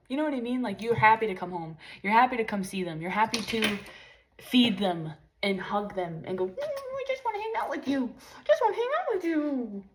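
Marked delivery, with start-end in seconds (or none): 6.5-9.8 high cooing pet voice, much higher; baby talk to a pet. Retold or imitated. Owner cooing to her cat, wants to hang out: high baby talk. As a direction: coo lovingly at the cat.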